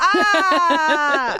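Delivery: high, loud "ah" cheer; excited open-mouthed shout. Real, not acted. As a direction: let out a high whoop. Host cheers as episode opens.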